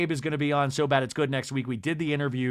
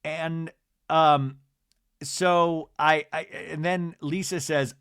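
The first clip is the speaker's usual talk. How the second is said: usual speaking voice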